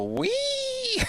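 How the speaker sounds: very high gleeful "Wee!" as he is swept away; Sim, swept up by the wind, squealing high. Acted. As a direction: squeal like a kid on a ride. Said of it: "like, go".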